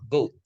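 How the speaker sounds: "Goat" with o dropping straight into oo; falling glide demo. Shown as a demonstration. Teacher joining the sounds of go and u, falling "Goat". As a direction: glide down through the vowel of "Goat". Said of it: "Langsung jadi turun gitu".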